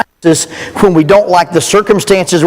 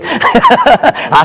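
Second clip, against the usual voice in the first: laughing; 7 semitones higher than usual. Real, not acted. Laughs at own joke.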